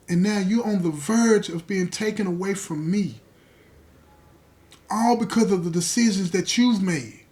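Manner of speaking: a broken father pressing his son, rising to full force; father's anguished plea. Retold or imitated. Father begging his son in the cell, swelling anguished plea.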